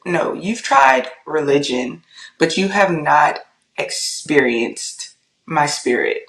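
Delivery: slow, measured pronouncement; voice of God. Retold or imitated. God corrects her in the kitchen, slowly and solemnly retold. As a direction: deliver it word by word with long pauses. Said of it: "like the audible voice of God".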